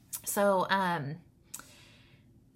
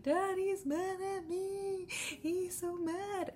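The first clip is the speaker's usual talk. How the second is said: put-on much higher, slow, far-off voice of a weepy child; crying little boy; 13 semitones higher, much slower than usual. Mom imitating her son upset that Daddy is mad at him, high and slow. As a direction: whimper it like a six-year-old who's been scolded. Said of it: "And he's like".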